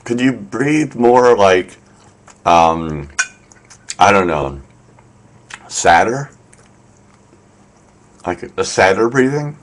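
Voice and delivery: halting, questioning, with long pauses; tentative suggestion rising into a question; hesitant direction. Retold or imitated. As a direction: fumble for the word as if unsure. Acts out awkward direction to narrator.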